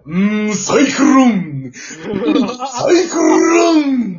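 slow, drawn-out dramatic cry; Wakamoto voice. Retold or imitated. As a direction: stretch each syllable theatrically. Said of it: "若本ボイスで".

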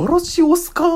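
very high, sing-song Kyoto よろしおすか; 京都弁のまね; Kyoto dialect imitation. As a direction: ham up the Kyoto lilt. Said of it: "聞くはずのない方言をね".

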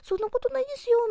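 high, fast, timid protest; weak voice. Retold or imitated. Host showing how he sounds when he speaks weakly, timid high voice. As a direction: squeak out a modest denial. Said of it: "私は弱々しく話すときは".

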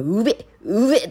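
slow mimicked cat heaving noise; imitated cat retching. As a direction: imitate a cat slurping and gagging. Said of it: "とか言ってきて".

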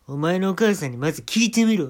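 rude loud command. Acted. Comic barks at the manager, loud and rude. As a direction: shout 「お前のお母さんにまず聞いてみろ」 bossily. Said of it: "タメ口で".

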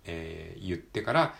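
usual speaking voice

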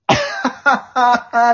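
forced high drawn-out giggle; nervous laugh. Retold or imitated. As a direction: fake a polite awkward laugh. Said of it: "死ぬほど愛想笑い".

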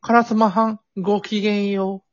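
high-pitched mock young lady greeting; Kyoto rich girl. Imagining rich Kyoto schoolgirls' morning greeting, mock-refined high voice. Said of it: "あのお嬢様たちは".

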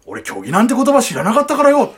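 a hint of a rough old man's excuse; imitating Watanabe. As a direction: lightly voice a sheepish excuse. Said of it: "はい、真似します。".

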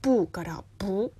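不 falling, then 不 rising in the second tone; tone change demo. Shown as a demonstration. Host demonstrating Mandarin tone sandhi, falling then rising. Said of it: "第二声になるんだよね".

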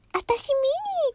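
very high, slow cutesy Minnie voice; Minnie Mouse voice. Retold or imitated. Imitating Minnie at home for her family. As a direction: squeak it out sweet and slow like Minnie. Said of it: "私がミニーちゃんの真似をするとな".

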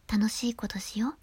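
soft breathy whisper, flirty; Bani's sultry whisper; whispered Bani impression. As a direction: whisper it close to the mic like Bani. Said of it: "バニちゃんをモノマネすることによって".

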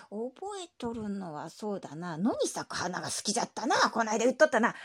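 put-on creaky old man who drawls; creaky wise old man. Grandpa voice held. (低くしわがれたおじいさんの声)

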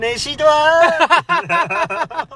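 very high, loud, hugely stretched shop clerk sing-song; hammed-up clerk asking about the receipt; cheery clerk voice. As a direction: stretch it out like a bright greeting. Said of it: "みたいな感じなんですけど".

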